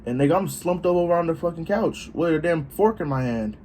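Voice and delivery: normal talking voice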